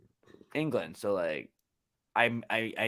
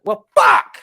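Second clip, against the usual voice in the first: much higher and louder exclamation; 11 semitones higher, 15 dB louder than usual. Retold or imitated. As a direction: blurt the swear out loud. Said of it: "They just shout it loud".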